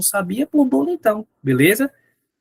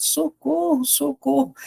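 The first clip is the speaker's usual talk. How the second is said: desperate cry; 8 semitones higher, slower than usual. Acted. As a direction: cry out for help, scared. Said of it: "Ele saiu desesperado". (grito aflito, agudo mas sem volume)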